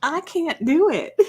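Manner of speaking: high, helpless laugh through the words; laughing admission. Real, not acted. Wife giving up on the purr, laughing. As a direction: laugh at your own failed attempt.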